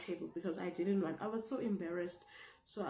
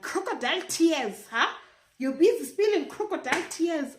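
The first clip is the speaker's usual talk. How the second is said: high, loud imitated telling-off; loud mock jeering; 9 semitones higher, 10 dB louder than usual. Teacher to a crying pupil, loud mock jeering. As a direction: jeer loudly like a bullying teacher.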